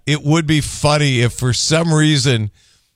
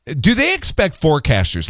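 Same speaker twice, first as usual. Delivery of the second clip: usual speaking voice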